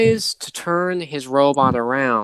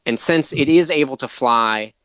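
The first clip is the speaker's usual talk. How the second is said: usual speaking voice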